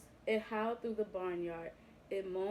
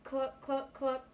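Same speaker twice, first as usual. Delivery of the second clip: higher, contented pig clucking; happy clucking; 4 semitones higher, slower than usual. A narrator's reading. Pig in the mud puddle, happy clucking. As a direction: cluck happily like a hen. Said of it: "Happily, he closed his eyes and said".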